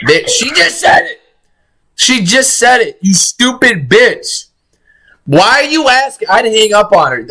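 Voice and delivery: high-pitched, indignant protest; exasperated outburst. Real, not acted. Viewer berating the emergency dispatcher on screen, exasperated. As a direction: scold in a high, fed-up voice.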